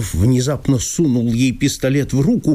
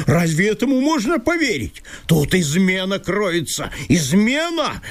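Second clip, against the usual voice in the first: high, furious landowner crying betrayal; hissing accusation; 10 semitones higher than usual. Acted. Chertopkhanov accusing Masha of betrayal, high and furious. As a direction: spit out the charge of treachery, pitched up. Он опять зашипел.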